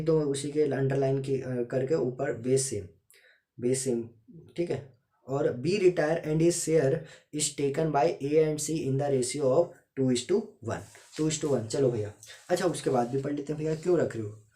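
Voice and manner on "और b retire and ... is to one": reading the question aloud word by word, slow and louder; slow, deliberate classroom dictation. Shown as a demonstration. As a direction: dictate it slowly so students can write. Reads question slowly, dictation style.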